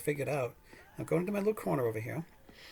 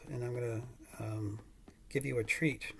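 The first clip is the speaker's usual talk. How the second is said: normal talking voice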